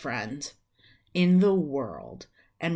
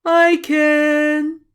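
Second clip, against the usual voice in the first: much higher and louder, a proud volunteer; eager heroic shout; 10 semitones higher, 11 dB louder than usual. Acted. Rumpel imagines answering the cat owner, a loud high eager volunteer. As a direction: shout it like the hero of the day. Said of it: "Rumpel imagining".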